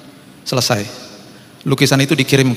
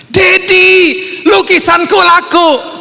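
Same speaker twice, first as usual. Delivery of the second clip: very high, loud, drawn-out squeal; child's joyful shout; 5 dB louder, much slower than usual. Retold or imitated. Child telling Daddy the painting sold, joyful high shout. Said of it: "dia berteriak".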